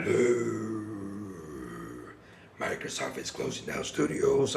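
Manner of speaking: low, soft, odd monster voice; a lightly voiced Godzilla delivering bad news. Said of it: "Godzilla ... be like".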